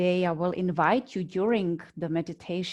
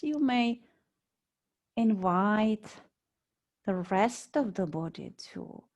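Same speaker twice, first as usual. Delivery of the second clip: guided meditation. Real, not acted. Yoga teacher guiding students lying on mats, calm meditation voice. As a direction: lead them unhurriedly into body awareness, phrase by phrase.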